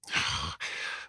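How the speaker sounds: long breathy exasperated sigh; weary sigh. Acted. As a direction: sigh out in frustration. Felix frustrated at departed slayer.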